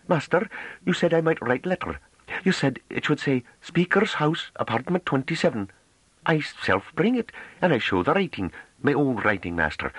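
put-on high, shy farm girl showing off a little; a timid country woman proud of her writing; rustic girl, high and bashfully proud; bashful pride. A narrator's reading. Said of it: "with a kind of bashful pride".

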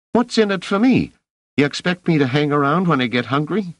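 put-on high cheeky boy voice, bargaining; street kid haggling. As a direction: push for a payoff, cocky. Rache haggles.

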